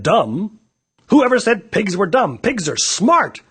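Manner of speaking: indignant. Acted. Megan defends the pig.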